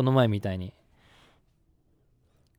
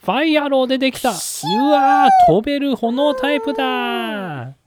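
excited; 10 semitones higher, 5 dB louder, slower than usual. Pretend. Fire Pokémon appears in play.

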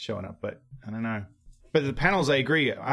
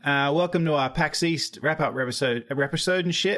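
hammed-up Aussie, a bit higher; mock Australian accent; 4 semitones higher than usual. Host redoes the intro as a jokey Australian. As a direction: play the cheeky Aussie host, broad. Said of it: "but more Aus".

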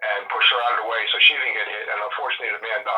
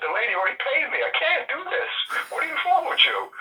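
high, pitched-up exasperation, half yelling; exasperated shop owner. Retold or imitated. Shop owner fed up with the haggling date, exasperated. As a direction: throw up your hands at a pushy customer.

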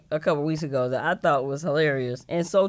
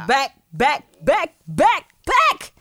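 chanting back, rising higher each time; excited; 8 semitones higher, 4 dB louder, slower than usual. Acted. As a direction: hype it up, building to a high shout. Hyped podcast return chant.

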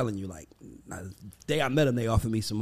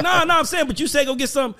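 put-on protesting young self; 11 semitones higher, 4 dB louder than usual.

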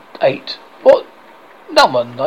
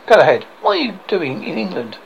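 ordinary talk, nothing marked

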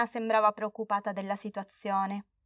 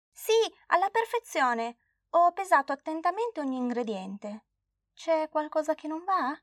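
put-on high, sure-of-herself girl voice; earnest little girl; 7 semitones higher than usual. Girl assures grandma she weighed everything, high child voice. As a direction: insist you followed it perfectly.

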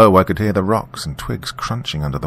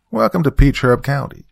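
put-on strong country accent; 8 semitones higher, 5 dB louder than usual. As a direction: Welcome to Peach Herb County in a thick Southern country accent, higher and louder. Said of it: "in a strong country accent".

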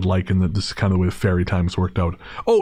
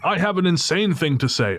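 higher-pitched eager caller, lightly voiced; zwariowany rozmówca; 7 semitones higher than usual. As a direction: imitate a caller with a wild request. Retold caller to ministry.

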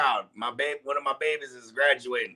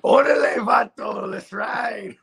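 high-pitched, hammed-up vato accent; mock vato; 5 semitones higher, 4 dB louder, slower than usual. Host does the catchphrase for laughs, hammed-up vato voice. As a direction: call it out like a cartoon vato. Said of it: "Let me do it like a vato".

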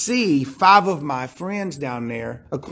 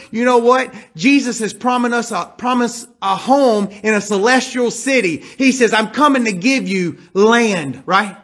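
higher and louder, driving the promise home; full-force preaching; 6 semitones higher, 4 dB louder than usual. Acted. Preacher to congregation about the promised home, fervent preaching. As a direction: preach it out with rising fire.

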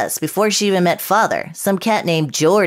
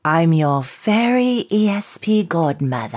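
put-on English accent. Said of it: "With this stupid accent".

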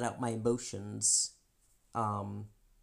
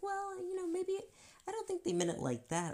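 much higher, light mock-innocent tone; mimicking Chris. Imitating Chris excusing the friend, high. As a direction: play the oblivious husband. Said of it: "And you're like".

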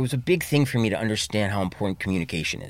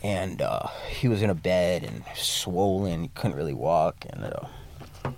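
quiet and subdued; 7 semitones lower, 5 dB quieter than usual. Real, not acted. Retelling his father's dying.

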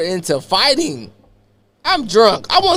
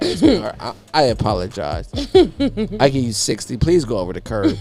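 smooth sweet-talking; 6 semitones lower, 4 dB quieter than usual. Retold or imitated. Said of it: "I'm leaning back like this".

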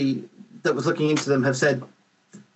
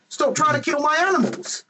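high-pitched indignant plea to the neighbours; exasperated scolding; 12 semitones higher than usual. Acted.

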